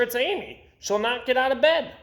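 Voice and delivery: teacher voice. A narrator's reading.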